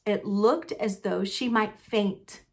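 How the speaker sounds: ordinary delivery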